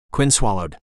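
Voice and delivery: ordinary delivery, nothing marked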